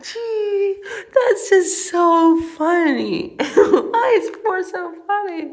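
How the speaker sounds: high, loud, helpless laughter through the words; real laughing. Real, not acted. Reader breaks off at a funny passage, laughing. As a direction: squeal it out while cracking up. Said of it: "I'm laughing so loud".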